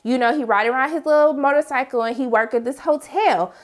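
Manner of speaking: normal talking voice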